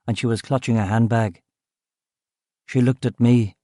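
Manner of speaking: ordinary delivery, nothing marked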